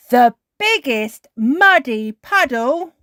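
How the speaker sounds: slow, loud, high storybook climax; triumphant reveal. A narrator's reading. Narrator reveals what they saw, slow triumphant build. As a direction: draw it out, building to a big finish.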